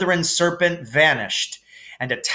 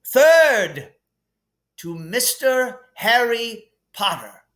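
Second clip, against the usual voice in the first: higher, grand headmaster voice; Dumbledore voice; 10 semitones higher, 3 dB louder, slower than usual. Acted. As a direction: hand out points with relish. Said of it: "said Dumbledore".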